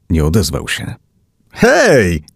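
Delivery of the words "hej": high, drawn-out shout for attention; higher, insistent hey; calling out. A narrator's reading. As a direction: call insistently to the driver. Calling to the unresponsive driver.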